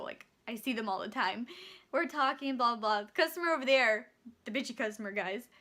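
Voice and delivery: ordinary delivery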